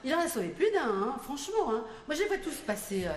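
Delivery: normal talking voice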